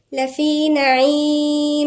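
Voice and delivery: slow, melodic tajweed chant; measured Quranic recitation. Acted. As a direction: recite the verse slowly, drawing out the vowels. Recites slowly.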